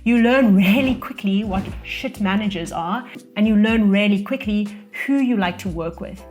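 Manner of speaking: ordinary talk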